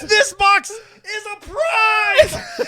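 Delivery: high, drawn-out mock shriek; freaking out. Joking as the Amazon package mover, hammed-up ecstatic shriek. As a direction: squeal it slowly in over-the-top glee. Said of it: "freaking out".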